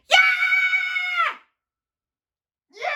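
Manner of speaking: high shouted yeah of terror; fishermen screaming. Acted. Men on the boat screaming in the storm. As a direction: scream out like frightened sailors. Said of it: "the men on the boat screamed".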